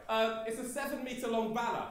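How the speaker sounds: ordinary talk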